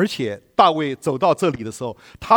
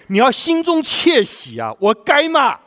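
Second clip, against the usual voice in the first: excited preaching; 11 semitones higher, 6 dB louder than usual. Real, not acted. Preacher exclaims joyfully.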